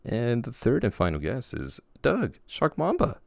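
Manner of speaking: high-pitched, playful introduction; odd announcing voice. Real, not acted. Host introducing the final guest, odd high voice. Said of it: "I don't know why I'm talking like that".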